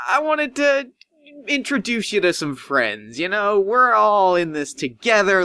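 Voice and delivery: smarmy host selling togetherness; oily Doctor Cook. Acted. Doctor Cook ushering the guest in, high and oily.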